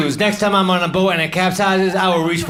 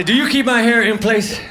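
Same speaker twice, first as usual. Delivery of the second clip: mock interrogation; 4 semitones higher than usual. Interrogating the fan.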